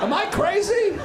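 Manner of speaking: ordinary talk, nothing marked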